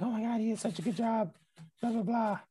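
high, soft imitation of a delighted woman; happy gushing. Retail crime lady praising the guard, high happy gushing. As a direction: gush in a mock high voice, then blah it away. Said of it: "she was all happy".

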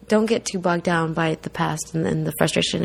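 usual speaking voice

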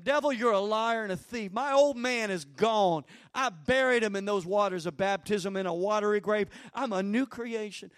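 defiant words spoken against the devil; bold declaration. Shown as a demonstration.

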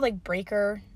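ordinary talk

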